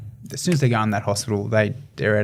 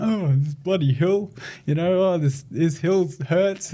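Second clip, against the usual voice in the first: high, drawn-out moan; mock whingeing in a raised pitch; whinging; 6 semitones higher than usual. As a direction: whine it out slowly, mimicking complaining runners. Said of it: "like, whinging".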